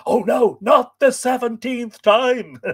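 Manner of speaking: high, slow mock horror; mock dismay. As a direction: ham up the dismay.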